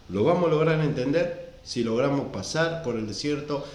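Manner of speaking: normal talking voice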